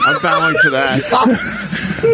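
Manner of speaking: drawn out very high through laughter; laughing. Acted. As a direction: stretch it out laughing. Mock reverence while laughing.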